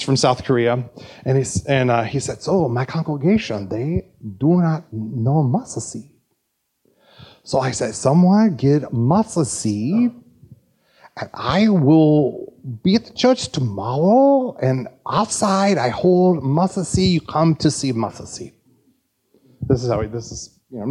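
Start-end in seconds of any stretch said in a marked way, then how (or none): 2.4-6.1 put-on South Korean accent. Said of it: "you like my South Korean accent". 7.9-18.5 slow imitated South Korean accent. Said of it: "you like my South Korean accent".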